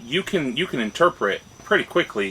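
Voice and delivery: ordinary talk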